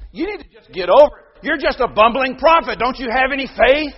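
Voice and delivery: a hypothetical God scolding the prophet; harsh rebuke. Pretend. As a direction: snap at him impatiently. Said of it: "God could have said".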